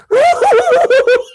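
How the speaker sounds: high, loud burst of mimicked laughter; hammed-up high cackle; re-enacted laughing fit. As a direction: act out helpless pointing-and-laughing. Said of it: "I started laughing and pointing".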